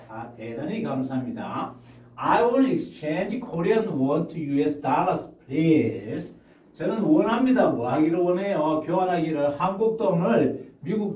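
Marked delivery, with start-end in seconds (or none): 2.2-6.4 slow, deliberate English read; teacher demo read; slow model reading. Shown as a demonstration. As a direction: read it slowly for learners. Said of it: "먼저 제가 한번 읽고".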